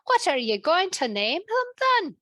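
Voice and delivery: high Scottish cook's question; Scottish cook asking her king. Acted. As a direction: ask it in a Scottish cook's lilt. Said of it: "in her Scottish accent".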